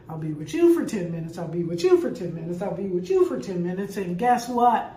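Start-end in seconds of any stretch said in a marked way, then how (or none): 0.1-4.0 quick, firm, repeated like a routine; fast, steady promise to clamoring kids. Retold or imitated. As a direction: rattle it off to each grandchild in turn. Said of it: "I said".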